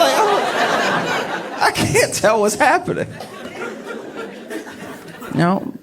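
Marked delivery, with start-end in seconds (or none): 0.0-3.1 baffled [name]. Retold or imitated.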